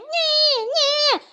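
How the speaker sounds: very high mock squeal; mocking imitation. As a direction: mimic someone to make people laugh, high-pitched. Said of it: "imitate how I was eating".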